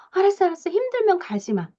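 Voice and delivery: high, fussing reassurance; over-protective parent. Acted. Lawyer-type parent lets child skip kindergarten, over-protective and worked up. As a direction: rush to soothe, pitched well up. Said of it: "팔짝 뛰어요".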